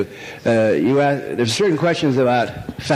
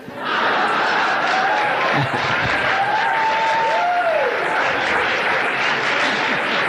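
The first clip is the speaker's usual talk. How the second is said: high laugh. Real, not acted. Long high laugh after punchline.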